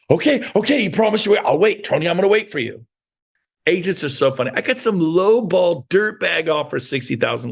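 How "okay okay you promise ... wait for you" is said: higher, pleading agent voice; lightly voiced desperate agent; eager agent. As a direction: play the anxious buyer's agent. Eager agent begging to wait.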